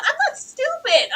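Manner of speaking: high, insistent daughter voice; a daughter telling her dad she isn't stupid; indignant daughter. Retold or imitated. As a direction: stand up for yourself, up high.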